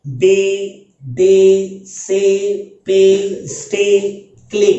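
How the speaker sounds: बे दे से पे स्टे क्ले each with a long ay; long ay words. Shown as a demonstration. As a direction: read each word with a stretched ay. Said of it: "लंबा उच्चारण निकला". (हर शब्द में लंबी ए ध्वनि)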